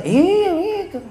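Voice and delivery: very high, stretched mock squeal; exaggerated squealed agreement. Preacher acts out the reply to Rizka, exaggerated squeal. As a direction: stretch the word way up high, hammed-up.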